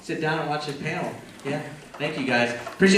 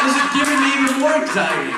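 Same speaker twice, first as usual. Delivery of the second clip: higher and louder panicked complaint; 9 semitones higher, 6 dB louder than usual. Retold or imitated. Complaining to friends that the weed causes anxiety, panicked. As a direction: sound freaked out and overwhelmed. Retells panicking while high.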